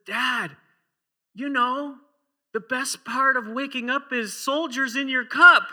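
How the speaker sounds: put-on proud little boy. Son announcing the soldiers in the cup, high and loud. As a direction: deliver it like a proud kid's jingle. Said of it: "And his son says".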